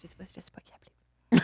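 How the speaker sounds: hushed panicked whisper. Retold or imitated. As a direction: breathe it out in a scared whisper. Retold panic, repeated under breath.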